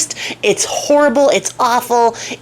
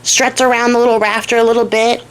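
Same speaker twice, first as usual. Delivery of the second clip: normal talking voice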